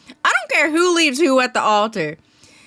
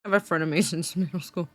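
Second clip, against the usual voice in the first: quiet aside; 5 semitones lower, 7 dB quieter, faster than usual. Real, not acted. Quietly repeating the story line.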